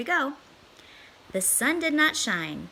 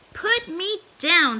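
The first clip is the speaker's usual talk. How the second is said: higher, louder, slow pleading fish; fish protesting; 8 semitones higher, 6 dB louder, slower than usual. Acted. Fish held up by the cat, slow loud protest. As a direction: drag out the plea like a dangling fish. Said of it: "said the fish".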